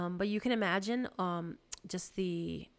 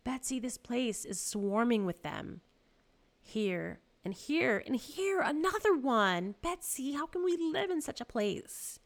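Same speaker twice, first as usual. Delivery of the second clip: higher, louder, rising alarm; distressed cry; 6 semitones higher, 5 dB louder than usual. A narrator's reading. Corrie to Betsy about the fleas, crying out. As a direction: build to a high frantic wail to a sister. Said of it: "I cried".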